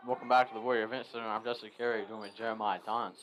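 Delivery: talking through a pretzel; words round a mouthful; mouth full. Real, not acted. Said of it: "Excuse me, I have a pretzel in my mouth".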